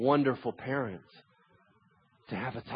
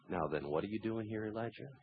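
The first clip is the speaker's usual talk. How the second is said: gentle whisper; 9 dB quieter than usual. A narrator's reading. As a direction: ask it gently, barely raising the voice. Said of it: "came a gentle whisper".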